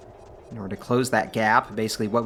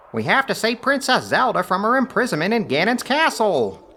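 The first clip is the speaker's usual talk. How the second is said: high fairy voice; 12 semitones higher, 5 dB louder than usual. Retold or imitated. Voicing Navi's nagging line.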